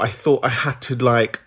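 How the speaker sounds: ordinary talk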